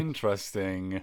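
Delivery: low, slow "interesting"; skeptical drawl. Real, not acted. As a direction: draw it out, unconvinced. Skeptical drawn-out reaction.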